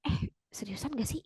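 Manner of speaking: whispered, incredulous question; hushed amazement, all breath; disbelief. Acted. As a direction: whisper it as if stunned. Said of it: "Gak nyangka gitu ya".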